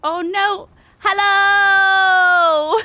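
long, high, loud cry of hello; startled shout. Real, not acted. Player shocked an enemy jumped him. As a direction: yelp it, caught off guard.

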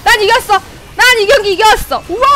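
high, loud victory cry; triumphant shout. Real, not acted. Streamer celebrating a won match, loud triumphant cry. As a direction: crow over the win.

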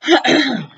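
loud throat clear before speaking. Acted. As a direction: clear your throat to begin. Before Cyrus's speech.

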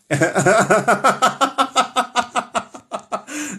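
high, long staccato cackle; laughing. Real, not acted. Host mocking the señora's looks, high cackling laugh.

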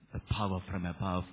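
usual speaking voice

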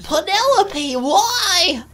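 high-pitched nasal lament; Bentley. Acted. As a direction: wail like a betrayed cartoon nerd. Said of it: "I can do a pretty good Bentley".

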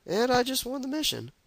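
high surprised exclaim; suddenly thrilled, pitch jumps. Real, not acted.

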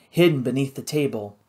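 usual speaking voice

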